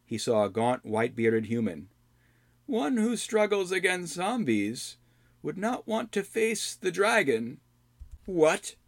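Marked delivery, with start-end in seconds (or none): 2.7-7.6 put-on high, slow old hermit; slow cryptic old man warning. Old man Rydoth speaks. 8.2-8.8 surprised hero voice. Acted. Albrecht reacts.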